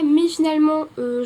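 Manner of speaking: usual speaking voice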